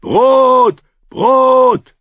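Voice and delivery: high, loud bellowed chant; roaring. A narrator's reading. Hungry mob chanting at the king, roaring. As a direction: bellow the word over and over. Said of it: "brüllten die Menschen".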